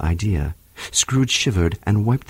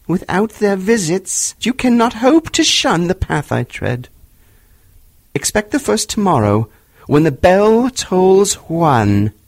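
a tormented spirit crying out; unearthly voice; 6 semitones higher than usual. A narrator's reading. Marley's ghost warns.

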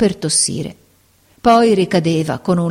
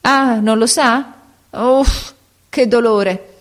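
slow, strained, breaking off in pain; a cramp cuts into her words; 7 semitones higher, slower than usual. Acted. Sick woman stops talking mid-sentence, writhing in pain. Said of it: "interrompendosi e contorcendosi".

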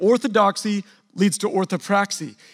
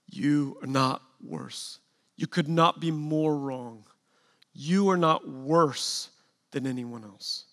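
slow, soft, lower and gentle; reassuring; 5 semitones lower, 7 dB quieter, slower than usual. Real, not acted. Pastor reassuring ashamed people in recovery, slow and soft. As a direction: lay each word down to comfort the ashamed.

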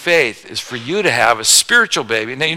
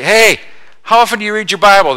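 much higher, louder and fast; eager new believer; 7 semitones higher, 7 dB louder than usual. Retold or imitated. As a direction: burst out with an eager question. New believer asking the pastor.

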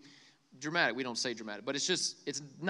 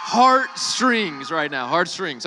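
touched exclamation; 10 dB louder, slower than usual. Real, not acted. Moved, exclaiming to crowd.

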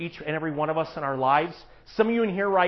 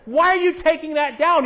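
indignant mom; 11 semitones higher, 7 dB louder than usual. Retold or imitated. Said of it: "She's like".

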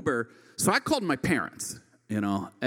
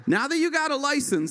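laughing; 11 semitones higher than usual. Real, not acted. Laughing mid-line.

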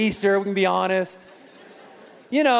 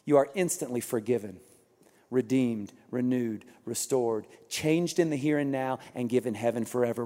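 lower, softer, earnest preaching; tender sermon close; 9 semitones lower, 7 dB quieter than usual. Acted. Pastor reassuring the church of forgiveness, soft and low. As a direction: speak the promise gently to the congregation. Closing gospel declaration.

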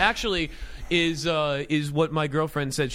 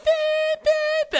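very high, piercing electronic beep; shrill alarm tone done by voice; imitated smoke alarm beep; 5 dB louder than usual. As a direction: imitate a smoke detector going off. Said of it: "I just hear this beep".

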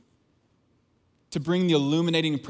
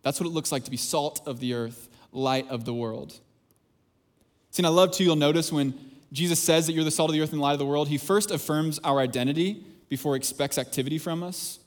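ordinary talk, nothing marked

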